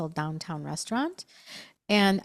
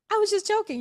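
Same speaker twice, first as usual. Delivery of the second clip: laughing imitation; 12 semitones higher, faster than usual. Imitating the choking man laughing.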